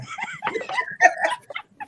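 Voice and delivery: squealing laugh; high laugh. Real, not acted. Laughing at the growl.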